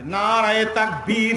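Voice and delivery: slow chanted shout of a slogan; rallying call. Real, not acted. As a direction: call it out long for the crowd to answer.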